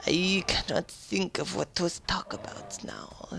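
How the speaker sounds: soft unvoiced whisper. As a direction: whisper it to yourself. Whispered musing.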